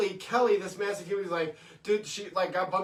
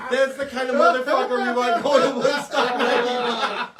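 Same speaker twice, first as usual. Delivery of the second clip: loud, high, drawn-out retold cheer; hollered approval; 6 semitones higher, 10 dB louder, slower than usual. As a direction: proclaim the winner over the laughter. Acted host hollering approval over laughter.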